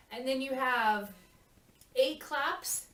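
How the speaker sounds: ordinary talk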